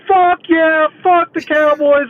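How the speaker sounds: high, loud re-enacted fan yell; going nuts. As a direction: whoop wildly at the TV. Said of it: "I just go nuts".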